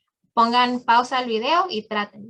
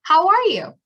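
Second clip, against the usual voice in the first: higher, lively question; friendly greeting; 7 semitones higher, 5 dB louder than usual. Shown as a demonstration. Teacher showing how to ask a housemate, bright and friendly. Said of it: "Pregúntele a alguien aquí".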